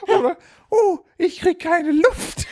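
high, slow strangled puppy gasp; gasping for air. Pretend. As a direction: gasp like a choking little dog.

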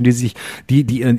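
ordinary talk

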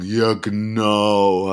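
very slow, stretched sarcastic agreement; drawn-out mock ja genau. Imitating coworker Robert's sarcastic reply: slow drawn-out ja genau. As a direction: drag it out like a bored coworker. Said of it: "Du, du sagst halt".

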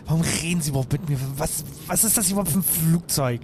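fast, rising, agitated rant; frantic rant. Acted. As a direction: blurt it out, panicking. Passagier verliert die Geduld. (hektisch und aufgebracht)

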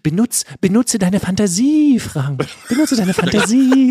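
wizard. Pretend. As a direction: proclaim it like a crazed wizard. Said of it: "Sag doch".

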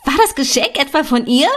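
high, loud shriek of a question; shrieking sister. A narrator's reading. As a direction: squeal it out in disbelief. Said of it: "und Lea kreischte".

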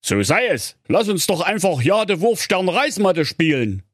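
high cheeky hero voice. Acted. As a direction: say it pertly.

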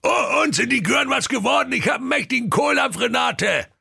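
booming impatient ogre voice. Acted. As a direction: demand dinner, starving. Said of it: "fragte ungeduldig".

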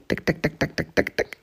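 usual speaking voice

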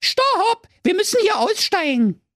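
high urgent repeated call; calling out stop. A narrator's reading. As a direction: insist the bus stops.

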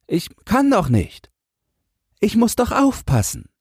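put-on high, mildly hesitant child's voice; unsure boy. A narrator's reading. Boy must stand guard and cannot come along, unsure. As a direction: refuse with a touch of uncertainty. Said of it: "sagte Jürgen unsicher".

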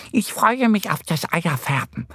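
high-pitched duck character voice; eager little duck. A narrator's reading. Annie the duck anticipating egg dyeing, eager. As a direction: look forward to it in a high duck voice. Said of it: "sagt Annie".

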